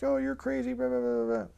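lightly voiced scoffing reply; mocking follower. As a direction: brush it off like a sneering kid. And he wrote back.

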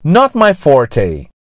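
slow Not my forte with forte as "for-tay"; slow, clear Not my forte, gringo-style forte; gringo accent. Shown as a demonstration. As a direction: model Not my forte in English, forte ending in "tay". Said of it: "pronunciado com sotaque gringo".

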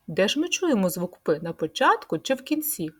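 ordinary talk, nothing marked

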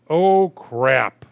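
startled dismay. Real, not acted. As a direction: blurt it out as the move goes wrong. Sudden mistake in the game.

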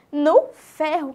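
usual speaking voice

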